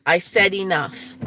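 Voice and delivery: loud, firm command; fed-up real scolding; sharp yell. Real, not acted. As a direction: snap it at the dog. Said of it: "I'm sorry I did yell".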